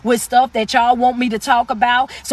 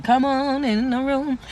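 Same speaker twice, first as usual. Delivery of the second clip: drawn-out catchphrase; 4 dB quieter, slower than usual. Shown as a demonstration. Host savoring a pet welcome to viewers, drawn out. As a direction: let each word of "come on in the room" linger. Repeats own catchphrase with relish.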